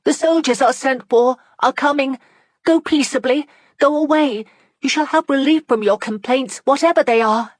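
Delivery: high, loud, desperate pleading; urgent plea. Acted. Margaret urging the angry crowd to go, high and pleading. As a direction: beg the crowd to go before the soldiers come.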